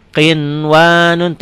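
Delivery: slow "Qinwanun" with a plain clear N; read as written. Shown as a demonstration. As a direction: stretch "Qinwanun", sounding the first N distinctly. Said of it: "tulisan dan bacaan sama".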